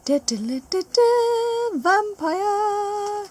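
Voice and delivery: high, loud fanfare build-up. Pretend. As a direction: sing the reveal. Fanfare announcing vampire card.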